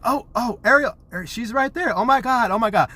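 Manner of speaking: high, loud mock panic; play-acted alarm. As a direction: fret excitedly, all for show. Acts out play panic over doll.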